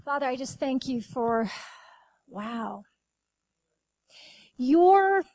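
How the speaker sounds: hushed thanksgiving prayer. Real, not acted. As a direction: pray it gently, with long pauses. Closing prayer.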